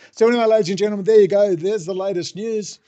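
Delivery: loud, high showman's announcement; hammed-up announcer flourish, easing off at the end; mock news announcer.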